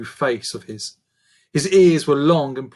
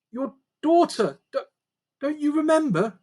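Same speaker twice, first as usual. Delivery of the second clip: put-on much higher young girl's voice, urgent; pleading girl; 14 semitones higher, 5 dB louder than usual. A narrator's reading. Daughter pleads with her changed dad, higher girl's voice. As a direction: beg him to know you.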